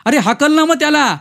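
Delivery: put-on boy's high-pitched shouted order; alarmed child shouting; loud yell. A narrator's reading. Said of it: "मग जोरात ओरडून म्हणाला".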